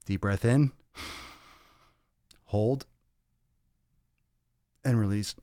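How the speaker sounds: slow, paced breath instructions; slow breathing cue. Shown as a demonstration. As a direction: count the breaths slowly. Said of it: "I need to calm down".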